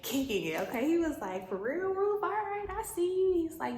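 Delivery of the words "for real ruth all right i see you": high, pleased grin in the voice; light up at her; excited flirting. Acted. Boaz to Ruth, high excited flirt.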